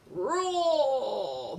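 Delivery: high, loud bellowing roar; dragon bellow. A narrator's reading. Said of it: "bellowed the dragon".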